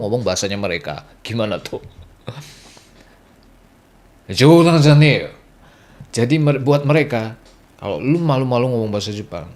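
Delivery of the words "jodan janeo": loud, slow put-on "no joke"; mock loud rebuke. Local scolds a foreigner ashamed of Japanese, loud mock rebuke.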